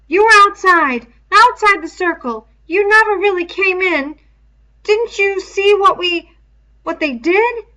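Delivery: put-on high, loud boyish voice, urgent; Piggy's high pleading voice. A narrator's reading. Piggy confronts Ralph after the dance.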